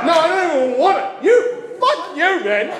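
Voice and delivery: high, loud, laughing fake fury; hammed-up angry shout; mock outrage. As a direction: pretend to be wounded and yell at the crowd.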